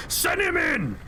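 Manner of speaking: high, shrill-pitched mock yell through the intercom; angry scream. Angry boss screaming through the desk buzzer. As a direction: bark the order like a furious boss. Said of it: "angry man screams".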